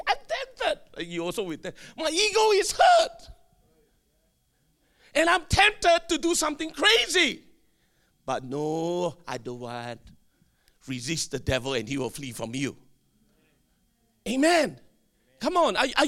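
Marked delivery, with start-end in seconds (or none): 0.1-1.1 acted temptation. Models the phrase for congregation. 2.0-7.4 high, loud dramatic complaint; acted wounded ego. 8.2-12.8 low, controlled voice; acted composed refusal in a deep register; disciplined self-restraint.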